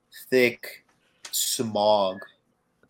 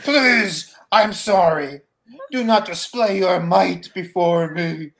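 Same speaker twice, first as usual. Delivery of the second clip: higher, louder pleading; cowering servant; 9 semitones higher, 8 dB louder than usual. Acted. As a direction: beg the mighty one to stop. GM voices a cowering NPC.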